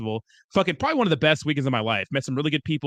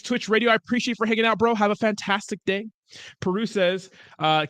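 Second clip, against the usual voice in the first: ordinary talk, nothing marked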